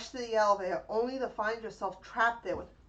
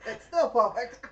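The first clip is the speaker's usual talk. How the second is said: laughing; 2 semitones higher, 7 dB louder, slower than usual. Real, not acted. Reading card while giggling.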